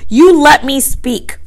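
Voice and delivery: indignant. A narrator's reading. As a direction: demand your turn indignantly, drawing it out. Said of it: "said Piggy indignantly".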